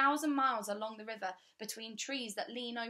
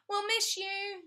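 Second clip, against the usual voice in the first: high, louder schoolgirl shriek; 12 semitones higher, 7 dB louder, slower than usual. Acted. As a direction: shriek it out at the departing friend. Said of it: "shrieked Melanie".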